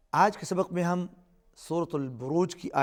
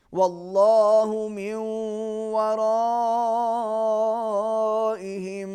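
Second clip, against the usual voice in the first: slow, measured tajweed chant; teacher modelling a recitation; Quran recitation; 4 semitones higher, 4 dB louder, much slower than usual. Shown as a demonstration. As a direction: recite it slowly for the pupil to copy. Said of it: "teacher models verse".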